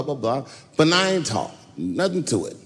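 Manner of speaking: ordinary delivery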